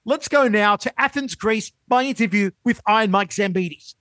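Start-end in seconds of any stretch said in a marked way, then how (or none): none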